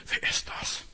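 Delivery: hushed unvoiced whisper; whispered question. Disciples secretly whispering among themselves, a hushed whisper. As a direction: whisper it as if afraid to be overheard. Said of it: "Die haben untereinander nur getuschelt".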